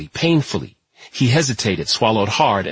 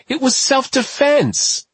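slow, much higher pleading protest; desperate protest; slower than usual. A narrator's reading. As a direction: beg them to see it was self-defense. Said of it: "he cried".

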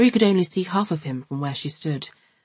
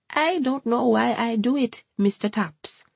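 high, Jamaican-lilting grandmother; a high, fussy Jamaican gran chatting; Hortense's Jamaican voice; 7 semitones higher than usual. A narrator's reading. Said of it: "said Hortense, shaking her head".

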